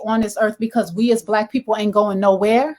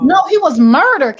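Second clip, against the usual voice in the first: louder and higher, firm; indignant correction; 6 semitones higher, 6 dB louder than usual. Real, not acted. Host calling out Shade Room, loud and indignant. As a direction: set the record straight, hard.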